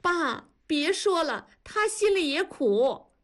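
high, drawn-out crying plea; tearful cry. A narrator's reading. Daughter begs father to stop, crying out. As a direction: cry out through tears, begging. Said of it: "男方就哭着喊".

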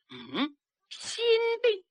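put-on old consort's high, slow, startled echo of the word; surprised old consort. The old consort, hearing the girl's diagnosis, asks back in a high, slow voice. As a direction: let the old man catch the word and drag it out up high.

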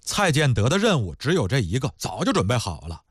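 ordinary delivery

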